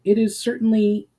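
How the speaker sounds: lightly voiced, gentle reply; Myra, not unkindly. A narrator's reading. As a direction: answer kindly, without edge. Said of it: "responded Myra, not unkindly".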